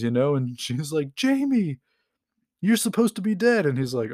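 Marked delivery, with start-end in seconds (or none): 1.1-3.7 much higher and louder startled scream; shocked scream. Retold or imitated. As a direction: shriek it in sudden shock. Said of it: "she just screams".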